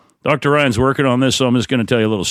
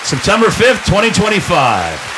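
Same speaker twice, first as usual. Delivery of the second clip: announcer; 8 semitones higher than usual. Acted. Announcer opening of show.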